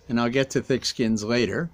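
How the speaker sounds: ordinary delivery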